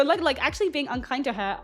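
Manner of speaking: ordinary delivery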